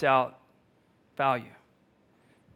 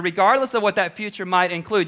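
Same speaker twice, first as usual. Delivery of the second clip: usual speaking voice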